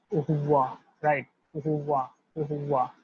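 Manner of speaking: au revoir said as "o-ruh-vwa"; French au revoir drill. Shown as a demonstration. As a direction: model au revoir for class. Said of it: "delivered as au revoir".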